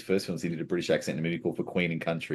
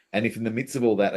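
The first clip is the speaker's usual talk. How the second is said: normal talking voice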